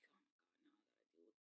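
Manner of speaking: stunned, drawn-out whisper; barely audible retold reaction; hushed disbelief. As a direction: whisper it slowly in shock. Retells a shocked reaction.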